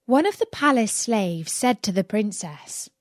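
ordinary delivery, nothing marked

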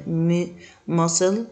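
ماسل drawn out slowly, syllable by syllable; slow model pronunciation. Shown as a demonstration. Teacher modelling ماسل for learners, slow and drawn out. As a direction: stretch ماسل slowly so learners can copy it. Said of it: "vocabulary drill, repeats each word".

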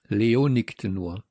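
ordinary delivery

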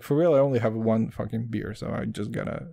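normal talking voice

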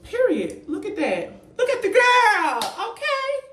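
high, loud squeal stretched on the last words; self-hype. Real, not acted. Vlogger admiring her lashes for viewers, gleeful hype. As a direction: cheer yourself on, soaring and drawn out. Hyping her own finished look.

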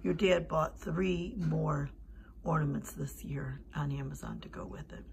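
ordinary delivery

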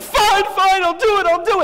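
high, loud whiny surrender; exasperated giving in. Retold or imitated. To the therapist at the last moment, high whiny surrender. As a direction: cave in like a cornered kid.

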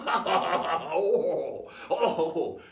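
loud "oh-ho-ho" guffaw; pirate laughter. Acted. Other pirates laugh at the third guy, hearty guffaws. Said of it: "laughing like pirates".

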